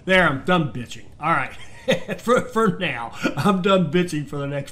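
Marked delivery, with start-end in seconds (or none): 1.6-2.1 breathy laugh. Real, not acted. Laugh after venting.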